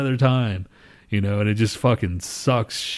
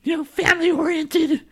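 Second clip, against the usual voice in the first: very high, strange cartoon Grinch voice; high grumpy cartoon villain. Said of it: "Mister Grinch".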